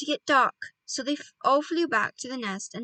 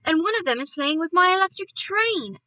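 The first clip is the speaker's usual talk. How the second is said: put-on higher, louder boy's protest; indignant cry; 6 semitones higher, 5 dB louder than usual. A narrator's reading. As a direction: cry out in complaint, pitch lifted. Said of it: "cried Philip".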